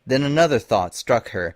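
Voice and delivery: usual speaking voice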